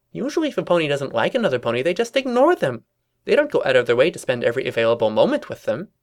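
quick, swooping cartoon reasoning; quizzical Pinkie. Pinkie questioning the rivals' logic, quick quizzical patter. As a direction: reason it out fast with high leaps.

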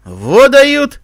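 loud, high scornful exclamation; spitting contempt. A narrator's reading. Commander reacts to the enemy's fake trap, spitting scorn. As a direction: spit it out in disbelief. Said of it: "сплюнул командир".

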